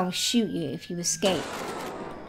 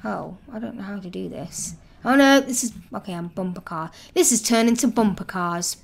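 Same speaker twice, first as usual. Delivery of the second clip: ordinary delivery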